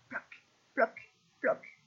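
short separate plops with pauses; dripping tear sounds. A narrator's reading. Narrator voices the whale's tears falling, plopping. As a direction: imitate big drops falling one by one.

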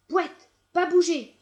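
loud, high commanding shout; stern order. A narrator's reading. Farmer ordering the muddy dog to stay, loud command. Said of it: "ordonna-t-il".

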